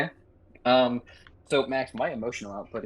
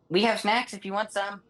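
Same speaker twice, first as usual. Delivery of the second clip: character voice; 10 semitones higher than usual. Acted. Character offers snacks.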